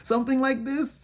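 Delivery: high, teasing nosy-neighbour voice; lightly voiced prying bystander; mock nosy onlooker. Voicing curious onlookers, mock question.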